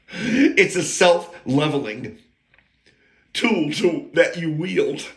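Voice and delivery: slow, laughing repeat of "self-leveling"; chuckles out the words "It's a self-leveling" slowly. Shown as a demonstration. Amused at his own phrase, repeating it with a laugh.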